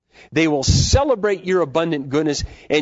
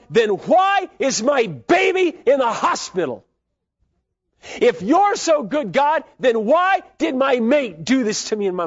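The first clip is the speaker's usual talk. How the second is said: pleading complaint; 8 semitones higher, 3 dB louder than usual. Retold or imitated. Hurting believer crying out at God, high and loud. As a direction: demand answers from God.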